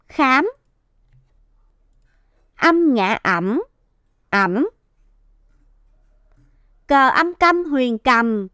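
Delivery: slow, drawn-out syllable-by-syllable chant; slow spelling aloud. Shown as a demonstration. Teacher leads the class in practising syllables, spelling them slowly. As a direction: sound out each word, then repeat it whole. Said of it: "cùng cô luyện đọc các tiếng này".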